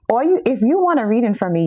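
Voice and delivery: ordinary talk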